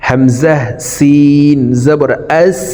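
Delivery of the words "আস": আস ending in a long hissed s; airy sin. Shown as a demonstration. Teacher demonstrating sin, আস with air released on s. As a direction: blow air out through the s of আস. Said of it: "বাহিরে বাতাস বের করে".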